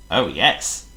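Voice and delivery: ordinary delivery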